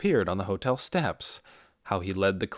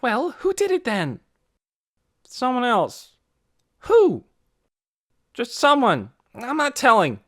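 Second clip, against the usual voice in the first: loud, high back-and-forth; children arguing; heated argument; 14 semitones higher, 7 dB louder than usual. A narrator's reading. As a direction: press him hard, he snaps back. Heated exchange Lena and Torrin.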